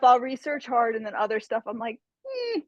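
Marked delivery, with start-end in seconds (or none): 2.3-2.6 high, soft squeaky "hmm"; pondering hum. Retold or imitated. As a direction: hum a doubtful little note, high and quiet. Imitates own pondering noise.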